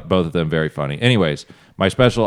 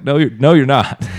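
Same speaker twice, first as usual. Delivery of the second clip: higher, louder disbelieving rebuttal; mock contradiction; 7 semitones higher, 7 dB louder than usual. Comic telling off self-styled communists, mock contradiction. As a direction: shoot it down, loud and incredulous.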